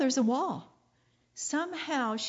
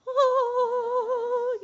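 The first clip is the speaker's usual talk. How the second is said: dreamy sigh; 12 semitones higher than usual. Retold or imitated. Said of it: "she's in that abiding lovey-dovey".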